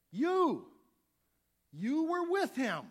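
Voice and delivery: high, loud and slow: you were with him; shouted accusation. Acted. Second accuser yelling at Peter, loud and slow. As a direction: point and shout it out, slowly.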